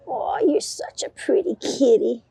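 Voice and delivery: dote on the kitty; high cooing praise; pet talk. Real, not acted. Praising the cat.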